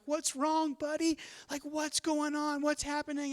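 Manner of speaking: put-on high, gentle voice for an upset toddler; comforting dad. Father to his crying son, high-pitched and comforting. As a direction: coo with concern to a little one.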